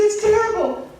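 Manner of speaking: high, hammed-up wail; mock crying. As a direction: wail theatrically to a passer-by. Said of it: "why are you crying?".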